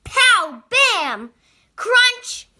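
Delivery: toy battle noises. A narrator's reading. Playing with toy, sound effects.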